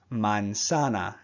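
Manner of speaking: "manzana" slow, with every A an open "ah"; slow Spanish manzana. Shown as a demonstration. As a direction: stretch "manzana", same "ah" on all three A's. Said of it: "Every A makes the same sound".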